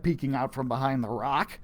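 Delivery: ordinary delivery, nothing marked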